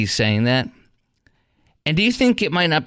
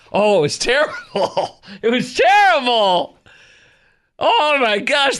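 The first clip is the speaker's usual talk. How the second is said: laughing it out, pitched up high and drawn out; 9 semitones higher, 3 dB louder, slower than usual. Real, not acted. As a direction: crack up while saying it's terrible. Laughing at the Haitian spokesperson.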